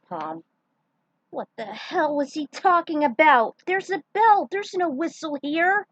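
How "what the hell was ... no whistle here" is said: loud, higher, fed-up shout; exasperated yell. Acted. Ayame scolding the team about the missing whistle, loud and higher. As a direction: yell it in disbelief at the mix-up.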